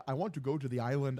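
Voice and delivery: low-key computer-voice imitation; a hint of robotic monotone; Stephen Hawking voice. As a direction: lightly imitate a speech synthesizer, low and quiet. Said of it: "Hawking voice".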